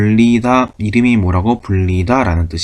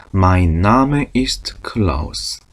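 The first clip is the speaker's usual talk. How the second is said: slow model sentence; much slower than usual. Shown as a demonstration. Teacher modeling German for students, very slow. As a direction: lay out each word slowly for the class. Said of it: "세 가지가 다 동일하죠".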